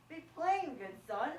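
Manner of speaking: firm and loud; stern command. Acted. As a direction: demand it sternly. Friar demands plain speech.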